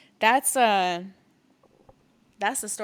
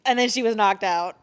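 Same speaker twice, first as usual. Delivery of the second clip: ordinary talk, nothing marked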